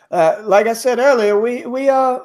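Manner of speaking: put-on [name] slipping into a light Australian accent; a touch of Australian in the innkeeper's voice. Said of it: "I keep sliding into Australian".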